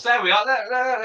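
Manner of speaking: high excited burst of "there". Real, not acted. Streamer thrilled a viewer answered, excitedly high. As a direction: jump up in pitch, delighted.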